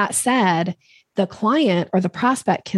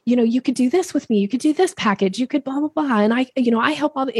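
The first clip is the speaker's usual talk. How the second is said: higher, rushed word-vomit pitch; gushing sales pitch; 6 semitones higher than usual. Shown as a demonstration. Speaker mocking an overeager salesperson, high and gushing. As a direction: gush all over them like an eager puppy. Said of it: "this dog calling energy".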